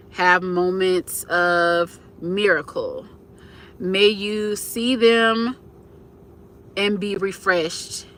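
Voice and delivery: slow, measured blessing; reading a prayer aloud. Acted. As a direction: read it slowly, with long pauses between phrases. Reads a viewer's prayer aloud.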